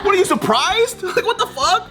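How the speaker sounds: high, laughing incredulous outburst; incredulous rant. Retold or imitated. Ranting at a surprised classmate, incredulous and high. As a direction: throw it at him in high-pitched disbelief. Continues retold tirade at classmate.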